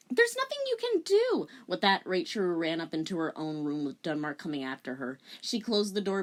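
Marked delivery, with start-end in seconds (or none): 0.1-1.5 put-on way up high, hopeless teen girl; high girlish voice. Rachel answering Denmark, high and hopeless. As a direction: squeak it out despairingly.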